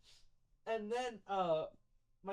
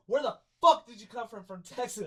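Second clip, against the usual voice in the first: mock outraged demand; retold yelling; 9 dB louder than usual. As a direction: burst out loud on the opening words, then ease off. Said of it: "she yelled in my face".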